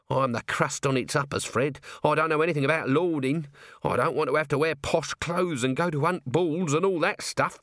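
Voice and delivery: fretful protest to a friend; hard-done-by working man's complaint; grumbling Nobby. Acted.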